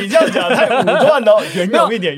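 laughing, high and loud; laughing mock scolding. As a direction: scold him through laughter.

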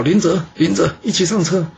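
high call over the shoulder. A narrator's reading. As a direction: call him back to the cart. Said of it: "回头大叫".

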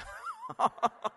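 high, falling laugh; quick high laugh after the joke; burst of laughter. Real, not acted. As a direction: laugh at the punchline. Laugh after Isaiah's verdict.

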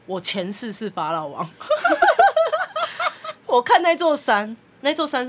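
slow, giggling through the words; can't hold back laughter retelling it; laughing while telling. Real, not acted. Storyteller cracking up over the aunt's pharaoh claim, laughing.

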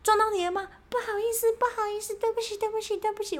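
high, flustered apologizing lady voice; frantic apologetic woman. Retold or imitated. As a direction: gush out sorry after sorry, way up high. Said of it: "她说".

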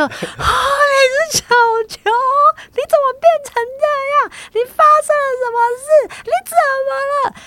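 very high, stretched gasp of astonishment, then rapid-fire questions; like a stunned fan firing question after question; shocked rapid questions. Retold or imitated. Imitating the fan's stunned outburst on meeting her, very high and astonished. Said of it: "如同连环炮一样的噼里啪啦一直穿过来".

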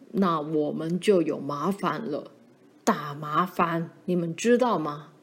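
low, slightly weighty fatherly warning; a hint of a grave, lecturing father; stern father. Father warns his children.

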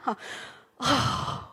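normal talking voice

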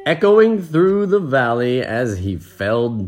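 slow, drawn-out storybook reading; story narration. A narrator's reading. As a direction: let each phrase roll on slowly. Reads the story aloud.

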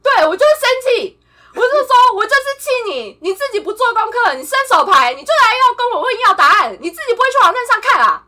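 站起来大声发火; 提高嗓门怒斥同事. Retold or imitated. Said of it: "我就站起来". (愤怒地大声斥责)

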